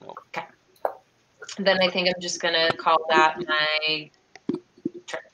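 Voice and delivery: ordinary talk, nothing marked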